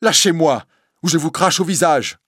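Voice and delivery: put-on a cornered girl threatening the priest, scared; frightened defiance. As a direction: fight him off with your voice. Esmeralda repels the priest.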